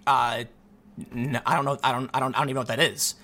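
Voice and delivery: low, halting, hesitant denial; mildly flustered, low-pitched stammer. Retold or imitated. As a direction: stumble over the words, dropped low and softly.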